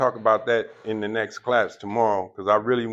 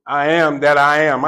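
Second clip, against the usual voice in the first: louder, solemn declaration of the name; voice of God; 8 dB louder than usual. Retold or imitated. God answering Moses, a loud solemn proclamation. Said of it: "What did he say?".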